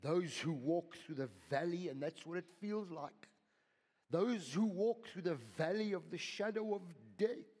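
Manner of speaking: loud, lifted preaching; preaching at full force. Acted. Pastor speaks of the valley of death to the church, loud. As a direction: lift it to the room.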